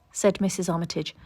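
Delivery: usual speaking voice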